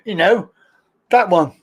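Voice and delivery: ordinary delivery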